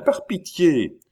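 high, imploring cry; cried plea. A narrator's reading. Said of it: "cria Chon, joignant les mains". (supplication aiguë)

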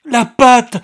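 a high, very loud frantic cry; frantic scream. A narrator's reading. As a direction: shriek it out, desperate. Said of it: "criait-elle frénétiquement". (cri frénétique, aigu et très fort)

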